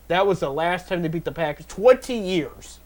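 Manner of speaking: ordinary talk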